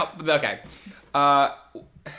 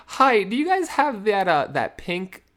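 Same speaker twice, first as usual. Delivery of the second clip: high, hesitant mock shyness; embarrassed ordering; 8 semitones higher than usual. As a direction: stumble sheepishly through the order. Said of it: "I got really embarrassed".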